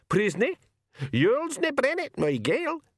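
high, squawky little pixie in thick Scots dialect; very high-pitched gabbled pixie brogue; blue pixie. Acted. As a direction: pipe up as a tiny, feisty Scottish pixie.

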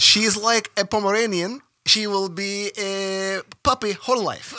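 hammed-up Eastern European pitch; Ukrainian accent. Describing a girl in mock Ukrainian accent. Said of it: "laughing at the Ukrainian accent".

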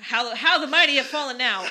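loud, laughing mock lament; laughing self-mockery. Real, not acted. Friend teased about eating nuggets, laughing self-mockery. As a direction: belt it out through real laughter.

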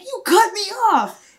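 high, slow accusation; accusing. Retold or imitated. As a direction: spell it out to his face. Confronting driver retold.

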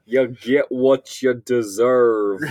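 loud, slow Southern Joker drawl; thick Southern accent. Acted. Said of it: "he has a big, thick Southern accent".

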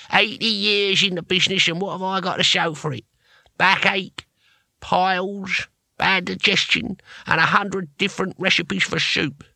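put-on high, creaky old man's grumble; miserable old barbarian. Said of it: "said Cohen miserably".

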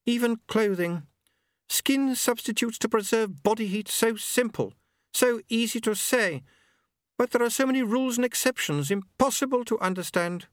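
high, prim and polite; Lady Lejeune voice. A narrator's reading.

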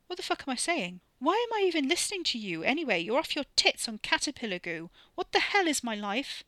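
high-pitched, incredulous self-questioning; exasperated disbelief. A narrator's reading. Wes shakes himself and questions his sanity, exasperated disbelief. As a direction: swing the pitch up in baffled exasperation.

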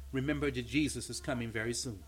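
low, soft bedside voice; quiet, comforting promise; gentle reassurance. Retold or imitated. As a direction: speak tenderly to a sick child. Gentle word to a dying child.

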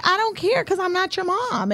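very high, exasperated retort; dismissive mock scolding. Aunt to nephew criticizing her screaming, dismissive retort.

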